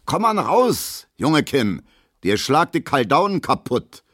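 sehr hoch und laut hinterhergebrüllt; brüll ihm derb nach; nachgerufene Drohung. A narrator's reading. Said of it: "ruft Gustav Schmelow hinterher". (Gustav brüllt Emil drohend hinterher)

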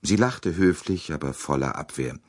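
ordinary delivery

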